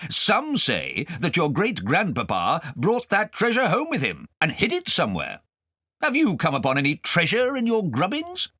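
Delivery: put-on high, loud blustering old gentleman; pompous guardian. Guardian quizzing Kay at dinner, pompous and loud. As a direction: quiz the boy grandly.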